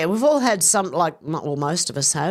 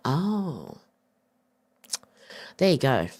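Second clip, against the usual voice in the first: normal talking voice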